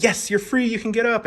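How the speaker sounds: high excited praise; happy release. Shown as a demonstration. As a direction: celebrate the dog's freedom. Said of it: "really showing them that".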